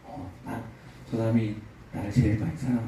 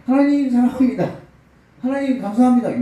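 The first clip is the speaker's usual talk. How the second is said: higher, mocking singsong of the farmer's prayer; mocking imitation; 10 semitones higher, 5 dB louder than usual. Abbot scornfully mimicking the farmer's prayer. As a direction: scoff at the simple prayer, pitch up. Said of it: "막 버럭 화를 내니까".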